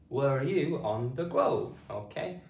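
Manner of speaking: ordinary talk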